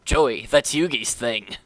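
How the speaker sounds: Pharaoh voice. Retold or imitated. The Pharaoh scolding Joey about stealing Yugi's line, deep and regal. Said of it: "he turns into the Pharaoh".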